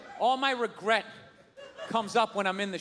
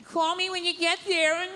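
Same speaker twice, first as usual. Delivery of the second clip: mock touchy-feely parent; 6 semitones higher than usual. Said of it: "touchy-feely".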